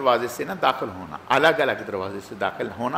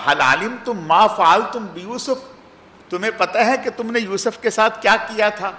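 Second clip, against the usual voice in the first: stern reproach; 8 semitones higher, 3 dB louder than usual. Retold or imitated. Yusuf confronts his guilty brothers, stern reproach. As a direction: confront them with what they did.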